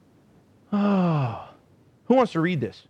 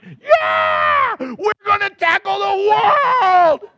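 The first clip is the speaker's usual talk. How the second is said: high, drawn-out triumphant holler; roaring with hyped-up zeal; fired-up yell; 13 semitones higher, 6 dB louder, much slower than usual. Retold or imitated. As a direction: whoop it out like a war cry. Acts out being fired up after prayer.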